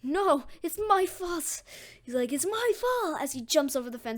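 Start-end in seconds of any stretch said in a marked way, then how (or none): 0.0-1.6 high, slow, drawn-out lament; sad self-blame. Acted. Deku seeing Bakugo captured, sad self-blame. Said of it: "really sad". 2.3-3.2 higher, quicker acted outcry; distressed self-blame. Deku leaping the fence, distressed self-blame. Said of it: "really sad".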